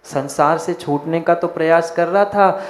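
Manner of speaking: ordinary talk, nothing marked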